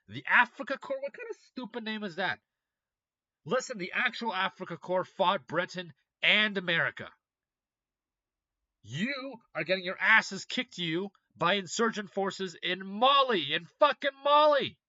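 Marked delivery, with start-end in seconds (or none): 0.1-2.4 loud and high, rising in disbelief; you cannot believe the name; incredulous. Real, not acted. Mocking Wagner's new name: loud incredulous rise. 8.8-14.8 slow and loud, peaking much higher and louder on the last Mali; mocking rant. Real, not acted. Taunting Russia over losses in Mali: building to a shout.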